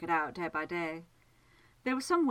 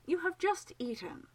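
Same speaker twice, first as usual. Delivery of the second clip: higher, exasperated protest; a friend scolding a greedy companion, exasperated; 11 semitones higher than usual. A narrator's reading. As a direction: throw up your hands at him, in a higher voice. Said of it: "in exasperation".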